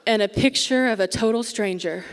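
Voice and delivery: ordinary delivery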